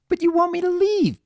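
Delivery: high, cross filly voice; childish growl. A narrator's reading. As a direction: growl the accusation as a small child. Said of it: "Pinky growled".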